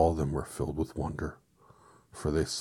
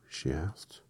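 plain reading voice

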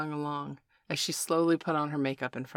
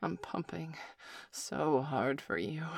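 soft, slow breathy whine; whiny; 5 dB quieter, slower than usual. A narrator's reading. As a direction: whine it out quietly, drawn out. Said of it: "she whined".

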